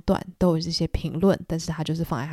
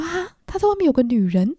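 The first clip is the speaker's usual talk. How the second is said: shocked gasp of a question, pitched higher and louder; surprised; 8 semitones higher, 5 dB louder than usual. Retold or imitated. Nick hearing Tom has a mistress, shocked and surprised. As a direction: react like the news just hit you. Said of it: "然后尼克就很惊讶".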